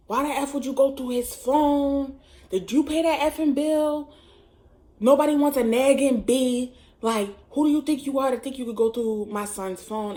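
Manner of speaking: put-on boyfriend's mother chewing her out, loud and high; retold angry phone tirade; angry scolding. Mom berates her son's girlfriend over his phone, angry scolding. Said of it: "she's cursing me out".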